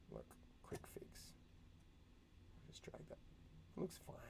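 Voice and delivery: sneaky whispered aside. Real, not acted. Teacher quietly fixing a slip behind the kids' backs, whispered. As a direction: whisper it as if hiding the mistake.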